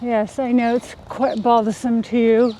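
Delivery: mock-British airs, measured and teasing; put-on British accent. Wife teasing husband about his British ex, put-on British accent. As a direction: keep up the fake British with a haughty edge. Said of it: "sound absolutely British".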